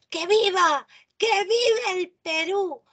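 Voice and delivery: loud, rising cheer for Peru; exclamatory cheer. Shown as a demonstration. Teacher shouting an example cheer to class, exclamatory. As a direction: cheer it out like a fan. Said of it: "Otra oración exclamativa".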